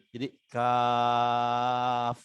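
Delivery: Kaf stretched very slowly; long Kaf. Shown as a demonstration. Teacher showing mad lazim on Kaf. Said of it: "maka dibacanya mat lazim".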